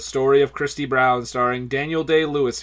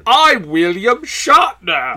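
slow, loud hammed-up Shatner voice with a fake British twist; bad Shatner impression; 7 semitones higher, 7 dB louder, much slower than usual. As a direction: overplay William Shatner, badly. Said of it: "that's terrible".

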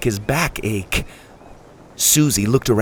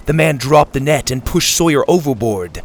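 usual speaking voice

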